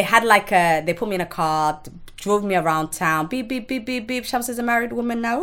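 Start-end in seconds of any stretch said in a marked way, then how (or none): 3.3-5.4 mock wedding horn. Mock car horn announcement.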